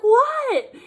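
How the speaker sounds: high, loud alarmed answer; alarmed mom. Retold or imitated. Mother running in answering the scream, high and loud. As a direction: mom calling back in a panic. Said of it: "she was like".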